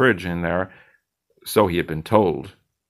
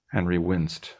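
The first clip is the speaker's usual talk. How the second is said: usual speaking voice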